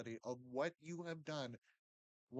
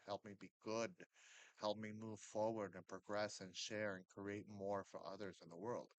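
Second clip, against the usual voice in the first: prayer; 3 semitones lower, 4 dB quieter than usual. Shown as a demonstration. Addressing the spirit guides, a soft earnest prayer. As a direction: pray it gently and humbly. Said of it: "open up and say".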